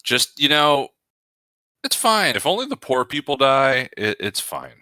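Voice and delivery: high, sneering it's fine; mock a callous pundit; sarcastic mock pundit. Mocking uncaring pundit.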